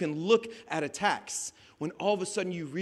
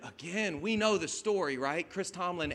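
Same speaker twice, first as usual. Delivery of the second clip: ordinary delivery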